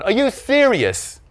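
high, slow disbelieving question; incredulous dad. A narrator's reading. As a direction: stretch it out in disbelief.

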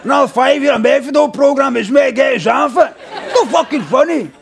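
high, put-on ranting voice; hammed-up indignant outburst, pitched right up; aggrieved addict character. Addict character protesting about heroin, high indignant rant.

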